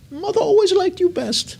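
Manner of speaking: high squeaky whiny sibling voice; jealous brother. Pretend. Auctioneer voicing a jealous brother, high whiny mock complaint. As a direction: whine it like the passed-over brother.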